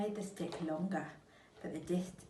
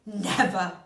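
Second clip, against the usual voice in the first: much louder cry of denial; 13 dB louder than usual. Acted. Missus Twit denying it to her husband, crying out. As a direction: cry it out defiantly. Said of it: "she cried".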